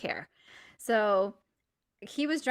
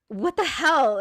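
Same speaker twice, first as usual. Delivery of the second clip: acted exasperation; 6 semitones higher, 8 dB louder, slower than usual. Acted quarrel in the car.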